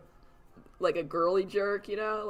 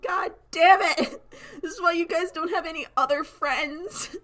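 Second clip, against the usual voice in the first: exasperated outburst; 9 semitones higher, 4 dB louder than usual. Retold or imitated. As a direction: throw up your hands at your friends. Exasperated at friends' bluntness.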